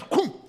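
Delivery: high, loud startled grunt with a laugh; kid's reaction noise. Retold or imitated. Boy in the pew reacting, high laughing grunt. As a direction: blurt it like a caught kid. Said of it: "this kid goes".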